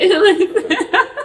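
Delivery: high, loud burst of laughter; laughing. Real, not acted. Survivor looking back at past trouble, loud high laugh. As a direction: crack up out loud, pitched high.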